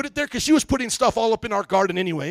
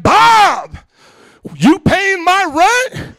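loud, high holler at the neighbor; retold indignant shouting; confrontational callout; 9 semitones higher, 4 dB louder, much slower than usual. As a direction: slowly challenge him across the property line. Acted confrontation with neighbor Bob.

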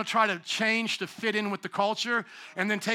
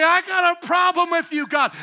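hammed-up defiant challenge, higher and louder; mocking defiant rulers; 7 semitones higher, 7 dB louder than usual. Preacher voicing rulers challenging God, hammed-up defiance. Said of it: "all the ones who say".

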